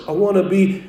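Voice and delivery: ordinary talk, nothing marked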